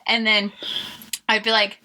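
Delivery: ordinary delivery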